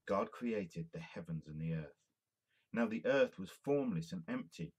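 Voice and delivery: ordinary talk, nothing marked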